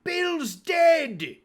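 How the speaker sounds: high, loud cry of surprise; shouting. Acted. Pirate in the house calling out the body, startled shout. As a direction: shout the discovery from inside. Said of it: "a voice shouting from the house".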